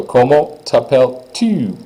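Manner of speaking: Comment t'appelles-tu slowly, each syllable clear; slow French model phrase. Shown as a demonstration.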